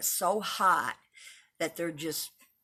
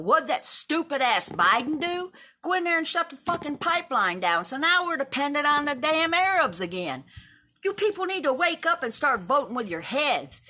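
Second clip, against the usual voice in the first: loud, much higher furious tirade; angry rant; 9 semitones higher, 4 dB louder than usual. Real, not acted. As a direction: rail at the president, voice up high. Political rant against Biden.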